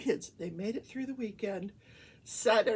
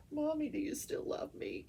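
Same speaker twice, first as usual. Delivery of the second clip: pet's voice; 7 semitones higher, 3 dB quieter than usual. Pretend. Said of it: "So she's like".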